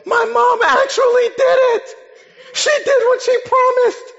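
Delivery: very high, loud scream of joy; ecstatic yell. Retold or imitated. Kid finding his GameCube at his aunt's, yelling ecstatically. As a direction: shriek it out like an overjoyed child. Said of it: "I yelled".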